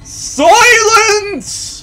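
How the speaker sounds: high, loud mock roar; hammed-up demonic shout; satanic roar. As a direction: bellow it like a hammy villain. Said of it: "shouted in a loud satanic roar".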